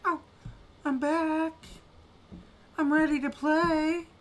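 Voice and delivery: high, far-off sing-song voice of the internet; mock internet voice. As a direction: play the cheerful glitchy connection coming back. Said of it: "and then it's like".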